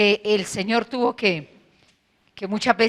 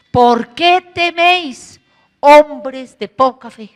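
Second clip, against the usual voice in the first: very slow, higher and louder rebuke; slow reproach; 3 semitones higher, 7 dB louder, much slower than usual. Acted. The Lord reproaches the disciples, slow and loud. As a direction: drag each word out as a solemn reproach.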